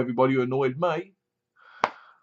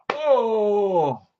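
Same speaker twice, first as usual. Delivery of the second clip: mock gasp; 6 semitones higher than usual. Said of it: "she feels it in her gut".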